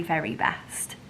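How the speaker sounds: breathy hushed whisper on the last words. Real, not acted. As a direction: let it drop to a reverent whisper. Savoring praise of the butchers.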